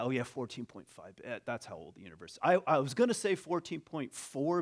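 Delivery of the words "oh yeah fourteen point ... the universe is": offhand, dropping low and soft; mock casual acceptance. Listener shrugs off a staggering figure, low casual mumble. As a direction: toss it off quickly as if it's nothing. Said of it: "the way we hear something like that".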